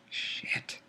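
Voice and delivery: breathy whispered swear; whispered curse. Real, not acted. Reader swearing to himself, whispered curse. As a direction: curse under your breath.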